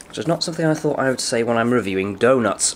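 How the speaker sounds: usual speaking voice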